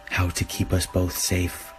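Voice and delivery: ordinary delivery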